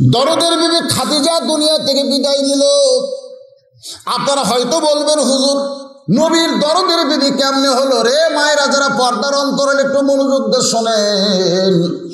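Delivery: high, sustained sermon call; impassioned high preacher's delivery; preaching at full force. Real, not acted. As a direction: hold a high, insistent pitch addressing the congregation.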